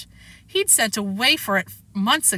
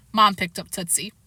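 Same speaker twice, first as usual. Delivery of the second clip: ordinary delivery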